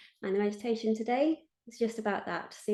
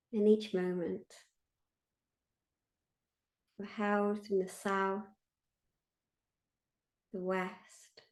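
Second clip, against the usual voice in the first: guided meditation; 3 dB quieter than usual. Acted. Meditation leader invites listeners to feel held, guided meditation. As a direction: lead listeners gently inward, leaving space after each phrase.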